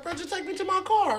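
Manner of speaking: pleading friend. Retold or imitated. Friend asking for a ride.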